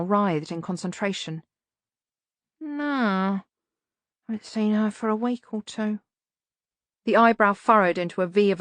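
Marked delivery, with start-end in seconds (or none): 2.6-6.0 grumbling hunchback servant; Igor voice. A narrator's reading.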